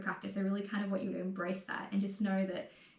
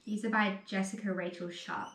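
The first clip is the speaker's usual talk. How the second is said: ordinary talk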